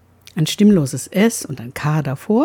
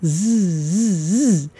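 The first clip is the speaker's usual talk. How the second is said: drawn-out buzzing zzz like a whining mosquito; imitated mosquito buzzing, slow; much slower than usual. Shown as a demonstration. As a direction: buzz it slowly like a gnat. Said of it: "so wie eine Mücke sirrt".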